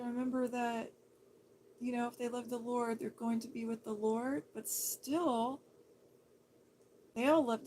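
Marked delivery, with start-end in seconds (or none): none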